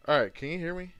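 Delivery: ordinary delivery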